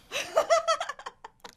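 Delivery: high bursting giggle; burst of laughter. Real, not acted. Laughing at her birth year.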